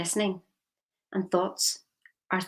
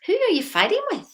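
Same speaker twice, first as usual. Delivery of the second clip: much higher, louder challenge; challenging; 13 semitones higher, 5 dB louder than usual. Real, not acted. Speaker challenging the audience about inner fights, raised and loud. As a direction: fire the question straight at them.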